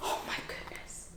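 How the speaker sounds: hushed whisper; awed whisper. Acted. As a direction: whisper in wonder at the tusks.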